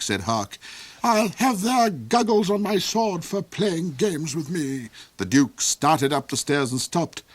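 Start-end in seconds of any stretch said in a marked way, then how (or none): 1.0-4.9 high, loud, cold villain rhapsodizing; the Duke's high voice. Acted. The Duke raves to Hark about Saralinda, high and loud. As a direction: soar up high and gloat over her.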